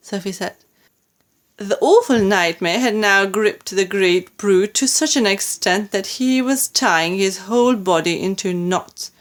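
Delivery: ordinary delivery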